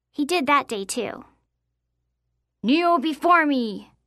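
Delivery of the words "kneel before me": slow, commanding knight voice; mock deep knight. A narrator's reading. Boy opens a knights game with his friend, slow put-on grand voice. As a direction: play a lordly knight, drawing the words out. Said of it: "he announced in his deepest voice".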